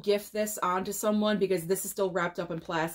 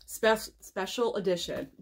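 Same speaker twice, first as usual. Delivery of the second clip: ordinary talk, nothing marked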